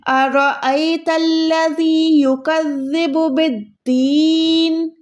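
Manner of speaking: slow chanted verse, बिद्दीन drawn out and closed on a silent-vowel n; measured tajweed recitation, long vowels held in अरअयतल्लज़ी and बिद्दीन; stopped final noon. Shown as a demonstration. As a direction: recite बिद्दीन with long "deee", ending on bare n. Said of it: "इसको साकिन कर देंगे".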